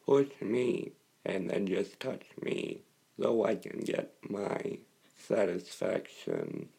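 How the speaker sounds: slow, quiet synthesized speech; measured word by word, like a talking computer; robot voice. Acted. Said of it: "I can do a robot voice".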